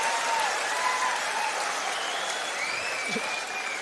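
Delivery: breathy high wheeze of a laugh; high unvoiced laugh. Real, not acted. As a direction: let out a high airless laugh. Laugh after punchline.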